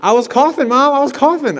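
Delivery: put-on teen faking a cough to his mom; innocent excuse. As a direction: play dumb to mom.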